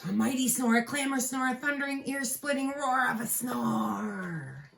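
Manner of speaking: big storybook climax; building dramatic refrain. A narrator's reading. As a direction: swell it up to a roar.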